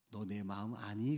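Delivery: low, soft and slow; tender retold question. God asks the missionary one question, tenderly. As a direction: ask it gently and intimately, as a retold word.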